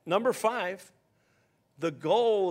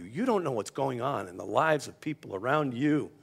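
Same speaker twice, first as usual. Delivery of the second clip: ordinary talk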